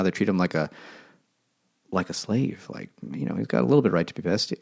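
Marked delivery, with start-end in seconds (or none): none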